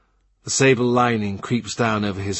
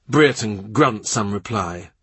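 usual speaking voice

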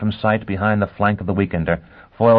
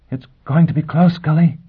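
Gisbella's voice; 8 semitones higher, 4 dB louder than usual. Acted. Gisbella over radio, racing.

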